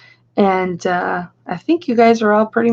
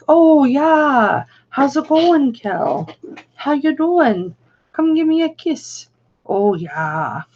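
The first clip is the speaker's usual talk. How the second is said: put-on hearty Yooper accent, higher and cheerful; Uncle Mick Yooper accent; 5 semitones higher, slower than usual. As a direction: greet your niece like a jolly Upper Peninsula uncle. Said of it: "they still talk like Yoopers".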